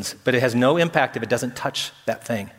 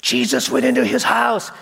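grumbling; 9 semitones higher than usual. Retold or imitated. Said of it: "other people started grumbling".

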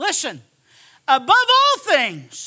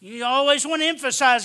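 ordinary talk

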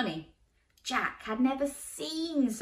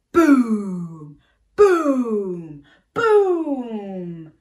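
booming footsteps; 13 dB louder, much slower than usual. Acted. Giant footsteps narrated.

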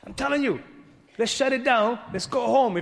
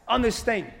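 louder, higher preacher cadence driving the point; preaching at full force; 5 semitones higher, 6 dB louder than usual. Acted. Preacher urging his congregation, full-force preaching. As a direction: preach it hard to the church.

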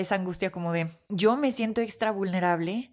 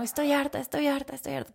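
fed up; 6 semitones higher than usual. Retold or imitated. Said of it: "Así empecé".

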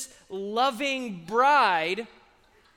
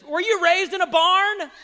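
louder mock scold from a parent; 6 semitones higher, 6 dB louder than usual. Parent scolding a child at the table, mock stern. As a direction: ask it like a fed-up mom at dinner. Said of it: "I heard that growing up".

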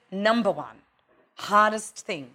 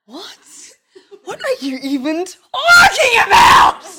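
higher, louder, laughing disbelief; incredulous shout; 10 semitones higher, 10 dB louder than usual. Retold or imitated. Mother reacting with laughing disbelief, loud and high. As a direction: blurt it out half laughing. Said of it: "I'm just like".

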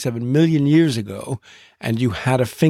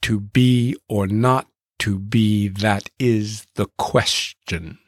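"To be or not to be, that is the question" slowed, beats marked; tapping da-DUM through Hamlet's line; iambic beat; slower than usual. Shown as a demonstration. Teacher marking each iamb in Hamlet's line for the class. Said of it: "a short and a long".

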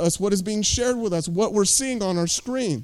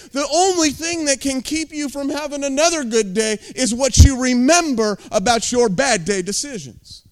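ordinary talk

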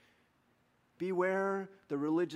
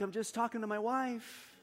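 softer, high little plea; re-enacted feeble protest; retold meek excuse; 4 semitones higher, 6 dB quieter than usual. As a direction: plead innocence in a small, helpless voice. Helpless excuse after being yelled at.

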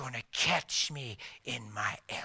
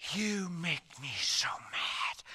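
hissed anger; 4 dB quieter, slower than usual. Retold or imitated.

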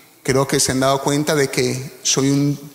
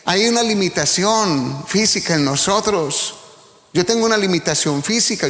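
preaching; 5 semitones higher than usual. Acted. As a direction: preach it with rising fervor. Preaching rises in intensity. (predicando con fervor, voz más alta)

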